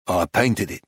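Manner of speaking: low, unforthcoming reply; laconic painter. A narrator's reading. Painter stonewalls dealer asking for the story, laconic. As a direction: state it low, giving nothing away.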